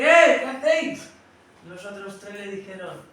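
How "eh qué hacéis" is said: king yelling at his bearers in fury; angry shout. Acted. King shouts at bearers after bumping his head, furious and loud. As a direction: bark it out, loud and high.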